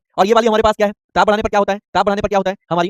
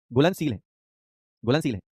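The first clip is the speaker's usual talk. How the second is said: quiet repeated answer; 5 semitones lower, 5 dB quieter than usual. Shown as a demonstration. Teacher quietly models the answer for students. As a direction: softly give the answer twice, voice dropping low. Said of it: "क्या है बाबू?".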